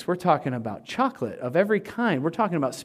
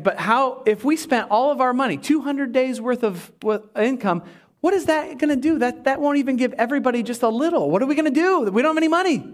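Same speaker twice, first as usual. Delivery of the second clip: imitating a flustered disciple, pitch climbing; mock panic; 5 semitones higher than usual. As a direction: build to high, hurried protest. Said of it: "they go into panic mode".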